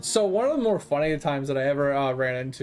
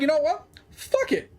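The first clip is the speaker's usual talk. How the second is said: fed-up outburst; 7 semitones higher than usual. Retold or imitated. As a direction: voice jumps much higher, exasperated and reckless. Fed up, blowing up cars.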